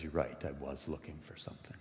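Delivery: hushed, breathy admission under the breath; whisper. A narrator's reading. Sid quietly confessing to the ferryman, whispered. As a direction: let it slip out as a soft whisper.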